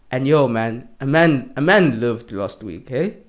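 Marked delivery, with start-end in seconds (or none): none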